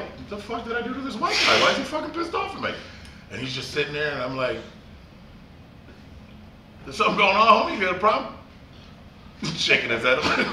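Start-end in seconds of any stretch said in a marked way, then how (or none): 0.0-2.7 very high imitated bafflement; mock-baffled complaint, pitched way up; bewildered protest. Voicing rival's bewilderment. 6.8-8.3 high, challenging retold question; acted-out testy challenge; mock confrontation.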